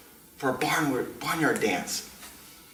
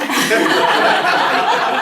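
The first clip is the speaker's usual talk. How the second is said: high, loud breathy cackle; shrieking laugh; 8 dB louder than usual. Real, not acted. Mocking the rich rival, shrieking laugh. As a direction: burst into a high wheezing laugh.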